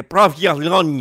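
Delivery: PROVOLONE belted out as "prov-ya-LON-ya"; hammed-up, loud provolone with an added J; mock-foreign provolone. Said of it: "adding a J in there".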